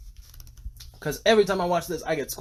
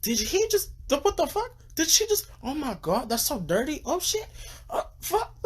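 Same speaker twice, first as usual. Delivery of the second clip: much higher, squealing shock; excited sister; 12 semitones higher than usual. Retold or imitated. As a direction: imitate the sister's scandalised squeals. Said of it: "She's like".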